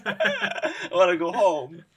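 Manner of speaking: laughing slowly. Real, not acted. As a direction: whine it through laughter. Laughing despair.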